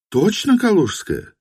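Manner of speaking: high doubting woman's question. A narrator's reading. (недоверчиво, женским голосом)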